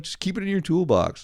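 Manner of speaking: high, chirpy mock-helpful voice; family's cheery advice. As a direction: imitate relatives brushing it off. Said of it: "They're like".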